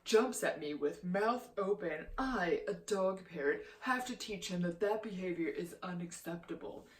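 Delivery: lightly put-on voice, mocking her prim apology. Vlogger quoting the YouTuber's apology, lightly mocking. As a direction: read her excuse back with a light sneer. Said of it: "she was like".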